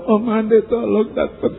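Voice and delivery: high, rising mock-frantic phone call; panicked plea. Dying man's child phones the preacher, panicked plea.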